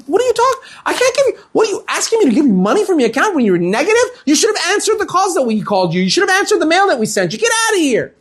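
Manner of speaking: high, outraged rant at a customer; retold furious outburst. Teller throwing an overdrawn customer out, shouting. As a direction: act out going nuts on someone. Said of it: "the teller goes nuts on her".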